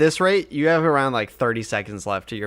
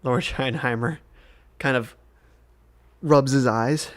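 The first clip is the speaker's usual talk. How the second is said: usual speaking voice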